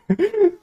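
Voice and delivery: brief high-pitched giggle; laugh. Real, not acted. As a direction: let a short high giggle slip out. Laughs between repeated lines. (risita aguda y breve)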